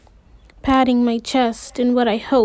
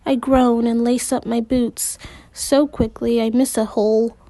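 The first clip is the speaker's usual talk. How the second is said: ordinary delivery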